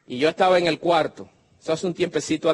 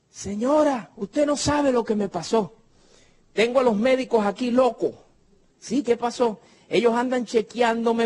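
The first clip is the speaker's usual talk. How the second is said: higher, excited woman on the phone; excited healed woman; 6 semitones higher than usual. Acted. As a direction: tell it breathless with amazement. Mujer sanada llama emocionada. (la señora sanada, emocionada al teléfono)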